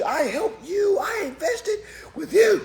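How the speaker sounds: very high mock wail; mock whining cry. Students complaining to the teacher in a high mock cry. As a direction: wail it like a wronged crybaby.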